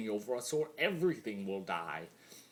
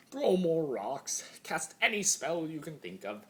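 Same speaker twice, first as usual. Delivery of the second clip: taunting dare; 7 semitones higher, 5 dB louder than usual. A narrator's reading. Taunting the brave little pony.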